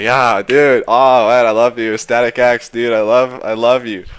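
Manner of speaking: put-on loud, high drunk-dude gushing; loud, slurred-free drunk affection. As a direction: gush at him like a happy drunk. Drunk fan gushing after show.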